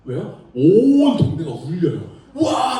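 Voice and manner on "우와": 크게 환호. Retold or imitated. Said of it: "하면서 아파트가 지진 난 것처럼 울려요".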